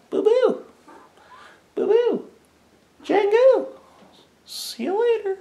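very high falsetto goodbye; pet call. Real, not acted. Owner leaving the cats for baby's room, high sing-out. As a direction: coo the names way up high.

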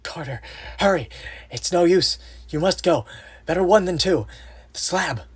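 high, strained, desperate urging; urgent stage whisper. A narrator's reading. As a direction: plead in a forced, projected whisper. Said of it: "his next whisper found me still held".

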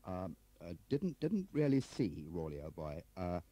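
flustered Crabbe figure. Acted. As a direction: hesitate and fumble through the news.

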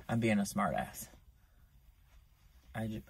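ordinary delivery

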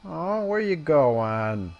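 high-pitched, playful calling after; mock pleading call. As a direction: coax a runaway foe in mock hurt. Calling after a fleeing enemy.

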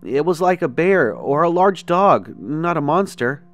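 put-on excited kid. A narrator's reading. Excited little brother.

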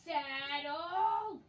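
loud, drawn-out call. Real, not acted. Player calls for the saddle, loud calling out. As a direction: holler it, stretching the word.

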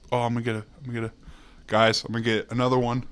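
ordinary talk, nothing marked

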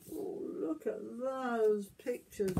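ordinary delivery

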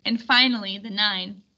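ordinary delivery

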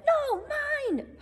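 high squeaky mock holler; squirrel hollering. Bruce the squirrel fighting Searle for the cone, squeaky holler. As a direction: squeal it back in protest. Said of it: "hollered Bruce".

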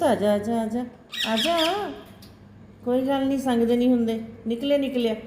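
high, sweet calling to a pet bird; coaxing pet voice. Real, not acted. Coaxing parrots out of cage. (ਤੋਤੇ ਨੂੰ ਪਿੰਜਰੇ 'ਚੋਂ ਬੁਲਾਉਂਦੀ, ਉੱਚੀ ਮਿੱਠੀ ਆਵਾਜ਼)

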